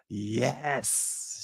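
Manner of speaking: long hissing S on "Yes"; drawn-out S. Acted. Host reading Aunt Pam's emphatic comment, "Yes" with a long S. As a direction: stretch out the final S of "Yes", softly. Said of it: "She emphasized with all those S's".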